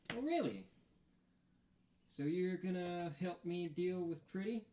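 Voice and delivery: a touch of arms-crossed sarcasm; sarcastic. Acted. Betty crosses arms, skeptical.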